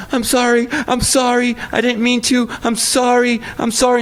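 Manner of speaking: high, panicked stream of apologies; daughter freaking out. Retold or imitated. Dad imitates his scared daughter apologizing, high and panicky. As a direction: plead frantically, like a scared little girl. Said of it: "my daughter freaking out".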